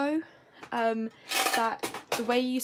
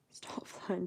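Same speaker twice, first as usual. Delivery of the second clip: laughing whisper; 9 dB quieter than usual. Real, not acted.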